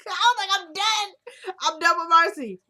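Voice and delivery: real high laughter through the words; cracking up, loud and high; helpless laughing exclamation. Real, not acted. Cracking up at Marcy's nerve.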